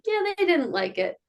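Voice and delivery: high-pitched retold kid reply; a hint of a kid's voice; imitating young daughter. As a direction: lightly mimic the child, pitched well up. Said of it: "she was like".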